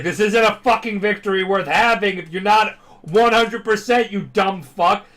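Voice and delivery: loud, high furious yell, slowing at the end. Retold or imitated. Angry winner yelling at his defeated opponent, furious shout. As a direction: scream it down at the beaten rival. Bakugo screaming at beaten rival.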